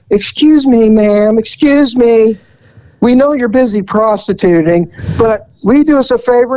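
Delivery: high, overly polite mock request; sweetly polite setup for a prank; polite lads. Carload of lads asking the woman on the corner, mock polite and high. Young lads addressing Diane.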